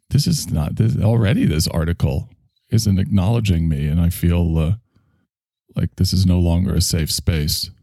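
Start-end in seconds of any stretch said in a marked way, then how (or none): none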